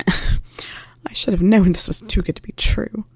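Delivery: breathy whisper, slow and broken; whispered despair. Acted. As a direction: whisper it like holding back a sob. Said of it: "strangled sob".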